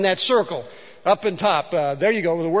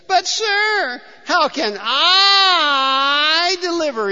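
high, loud, slow tearful wail; whining Gideon; 7 semitones higher, 4 dB louder, slower than usual. Acted. Preacher voicing Gideon's tearful whine to God. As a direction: wail like a coward begging off. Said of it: "wrings his hands and crosses his legs and whines".

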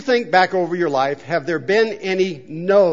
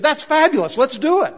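high, eager agreement; quoting an enthusiastic yes in a raised pitch; acted enthusiasm; 8 semitones higher, 4 dB louder than usual. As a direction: jump on the idea with delight. Acting Nathan's keen reply to David.

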